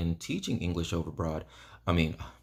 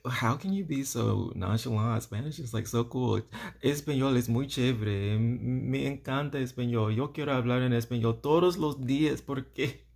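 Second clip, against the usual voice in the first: higher, gushing over Spanish; gushing excitement; 8 semitones higher than usual. Retold or imitated. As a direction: pour out your love for Spanish. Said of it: "I'm like".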